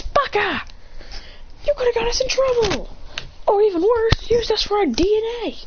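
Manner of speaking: high-pitched scolding outburst; acted angry yell. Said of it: "Then Ray yells".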